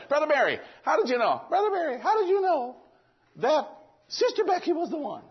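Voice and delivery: high-pitched mock youngster's voice; imitated eager kid. Youth camp kid asks the preacher about his wife, high imitation. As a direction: pipe up high like a kid pestering with a question.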